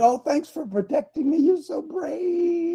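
high, soft, swooning woman's voice; adoring Eve. Acted. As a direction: gush in a high voice, stretching the last word. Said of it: "Eve would have loved and hugged him and said".

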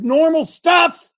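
shouted frustration; exasperated shout. Real, not acted. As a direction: blast the words out in frustration. Preacher erupts mid-sentence.

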